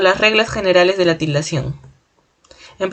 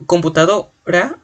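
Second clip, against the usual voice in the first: "computadora" slowly, with weight on "do"; slow teaching demo of "computadora", stressed on "do"; 2 semitones higher, slower than usual. Shown as a demonstration. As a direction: lean on "do" in "computadora", then detach "ra". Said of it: "la mayor fuerza de voz está en do".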